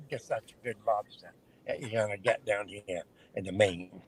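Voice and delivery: a hint of down east Maine accent on the lobster line. Shown as a demonstration. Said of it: "that's down east accent".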